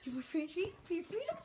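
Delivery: high sing-song dog talk. Real, not acted. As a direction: coax a dog with a treat. Talking to her dog.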